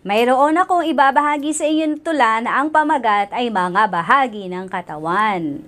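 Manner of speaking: normal talking voice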